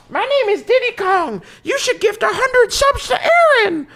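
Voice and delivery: very high squeaky cartoon monkey voice; high chipmunk-style character voice; Diddy Kong voice. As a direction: pitch way up like a little cartoon ape.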